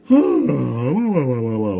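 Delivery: long high yawn from the tree; tree yawning awake; big yawn. Acted. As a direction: stretch out a sleepy yawn. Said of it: "A big yawn from the tree".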